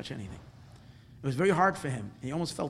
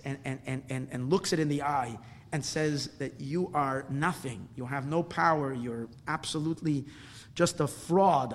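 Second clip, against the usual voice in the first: ordinary talk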